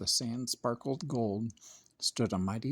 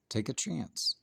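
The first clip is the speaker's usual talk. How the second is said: plain reading voice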